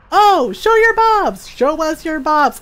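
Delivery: loud, high, drawn-out jeer; hammed-up crowd chant to tease; mock clamoring. Mimics people clamoring to tease.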